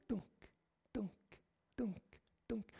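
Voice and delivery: soft whispered thuds; stones thudding. Retold or imitated. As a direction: breathe out the falling stones. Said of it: "de steinene ramler". (hviskende dunk som steiner som faller)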